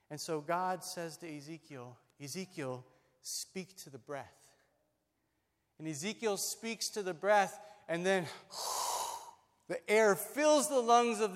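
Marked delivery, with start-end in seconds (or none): 2.2-4.5 solemn hushed command. Acted. God's solemn command to Ezekiel. 8.3-9.3 long airy whoosh of breath; rushing breath. Acted. Acts out breath filling the lungs.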